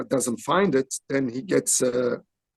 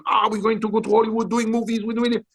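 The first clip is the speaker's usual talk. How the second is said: higher, lightly voiced bragging friend; a hint of a cocky friend showing off; mocking a boastful friend; 7 semitones higher, faster than usual. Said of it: "you know, saying".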